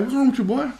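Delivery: high-pitched retold dad's question. Dad to crying son walking in, questioning. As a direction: act out a puzzled father.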